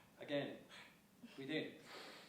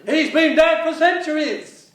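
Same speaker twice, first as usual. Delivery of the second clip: loud, higher and slow; exasperated shout; 5 semitones higher, 6 dB louder, slower than usual. Acted. Clown correcting partner, loud slow shout. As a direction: yell it in disbelief.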